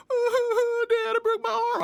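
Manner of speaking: high, loud wailing sob; hammed-up blubbering to dad on the phone; a grown man wailing like a little kid; mock crying. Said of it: "I'm, like, crying and shit".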